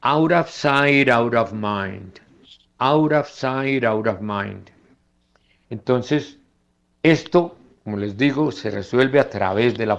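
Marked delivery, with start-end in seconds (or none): 0.0-4.7 Out of sight with each t tapped like a Spanish r; slow model of flapped t. Shown as a demonstration. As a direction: tap the t in out of between vowels. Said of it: "se pronuncian como R española".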